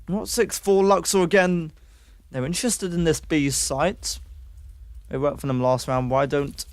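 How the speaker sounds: play-by-play casting. Acted. Casting resumes after chat.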